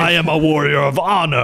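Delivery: high, hammed-up proclamation; proud alien warrior. Invader proclaiming his honor, grand mock-heroic voice. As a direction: declare it like a cartoon champion.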